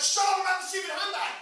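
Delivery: mock exasperated outburst; exasperated snap. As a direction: snap at him to drop the handbag topic. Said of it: "They'd be like".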